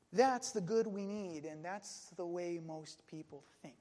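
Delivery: ordinary delivery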